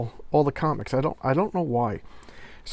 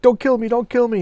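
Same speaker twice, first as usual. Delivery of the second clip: high, loud mock panic; begging for her life, hammed up; pleading; 11 semitones higher, 6 dB louder than usual. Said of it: "she's like".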